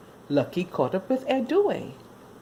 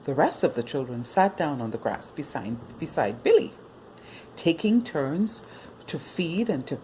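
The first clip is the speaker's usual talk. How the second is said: ordinary delivery, nothing marked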